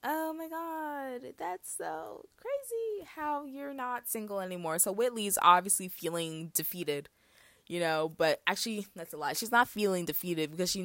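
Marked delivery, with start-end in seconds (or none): 0.0-4.8 high, slow fake-nice surprise. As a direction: drawl out false surprise. Whitley feigns surprise.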